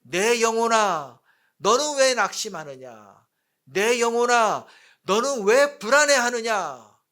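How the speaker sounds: loud, high, drawn-out declamation; crying out. Acted. Psalmist challenging his own downcast soul, crying out loudly. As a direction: call out forcefully to your own soul. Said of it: "라고 외칩니다".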